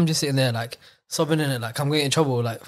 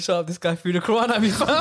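high-pitched mock protest, half laughing; hammed-up wail of outrage; retold fuming outburst; 6 semitones higher than usual. Boy snapping at his Quran teacher, retold fuming outburst. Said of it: "Bro, I was fuming".

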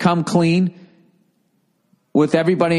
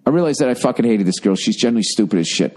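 fast reread; 3 semitones lower, faster than usual. Shown as a demonstration. Rereading letter quickly.

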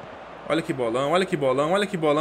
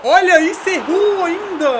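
high, loud, slow near-miss cry; excited outcry; 9 semitones higher, 6 dB louder than usual. Real, not acted.

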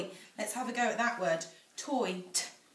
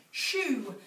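ordinary delivery

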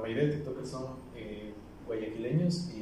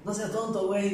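mock bickering; 9 semitones higher, 5 dB louder than usual. Retold argument among strangers.

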